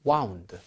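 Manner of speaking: "wound" as waund; wound rhyming with found. Shown as a demonstration. Teacher recites the participle of wind, "wound" with ow. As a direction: say "wound" with the vowel of round. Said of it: "이렇게 여러분들이 아셔야".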